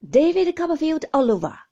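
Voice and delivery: loud, scornful exclamation; loud mock outburst of a stern aunt; cried out. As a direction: cry it out in contempt. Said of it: "cried Miss Betty".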